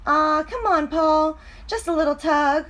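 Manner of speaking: high coaxing pigtail voice; urging pigtail. Acted. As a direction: coax him into one little tug. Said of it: "urged the pigtail".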